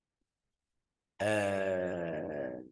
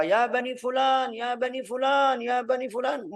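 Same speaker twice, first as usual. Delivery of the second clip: much higher ringing summons to the clans; calling out; 13 semitones higher than usual. Retold or imitated. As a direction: call the tribes from the mountaintop. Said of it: "Dia teriak, tapi manggil mereka". (berseru memanggil dengan nada tinggi)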